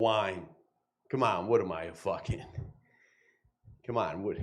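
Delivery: hushed, dazed mobster on mushrooms, soft and wondering; a mob boss whose mushrooms just kicked in, mumbling in awe; tripping mafia boss. Acted. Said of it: "my impression of the moment this mafia boss".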